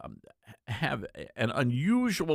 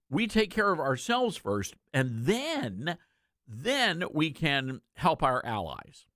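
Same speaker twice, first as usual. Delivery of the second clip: usual speaking voice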